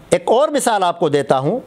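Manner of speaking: ordinary talk, nothing marked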